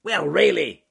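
high, loud irritable snap; bad-tempered snap. Bad-tempered beaver answering the bear, snapping. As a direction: snap it out impatiently. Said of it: "snapped Ben the bad-tempered beaver".

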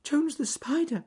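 high, emotional rabbit voice; tearful. A narrator's reading. Rabbit moved by spider's plight, tearful. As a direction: address him high, on the verge of crying. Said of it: "who was crying himself by this time".

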